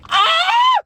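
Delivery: short high-pitched shriek; sudden loud yelp; mock scream. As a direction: let out a sharp high scream. Mock scream at his answer.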